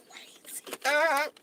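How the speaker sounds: breathy whispered aside. Real, not acted. Vlogger whispering an aside to her fans. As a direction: whisper it to yourself.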